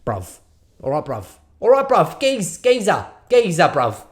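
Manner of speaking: hammed-up London geezer accent; English accent. Podcaster riffing on a London accent from Love Island. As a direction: play a cheeky London lad from Love Island. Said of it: "English accent out of my brain".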